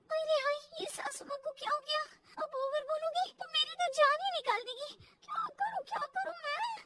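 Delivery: high, soft, whimpering worry; flustered panic. Acted. Daughter-in-law finds mother-in-law frozen, flustered panic. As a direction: fret anxiously, pitch climbing.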